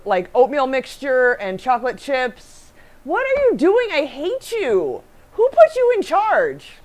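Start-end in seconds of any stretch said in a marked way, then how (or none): 3.0-6.7 high, slow, mock-indignant scolding; teasing fake anger at a laughing partner; mock outrage. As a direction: playfully scold the co-host.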